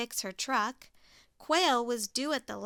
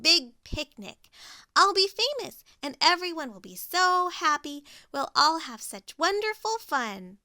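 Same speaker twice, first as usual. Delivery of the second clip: higher and louder, bubbling with excitement; excited Pig; 4 semitones higher, 6 dB louder than usual. Acted. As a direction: gush about the grand plan.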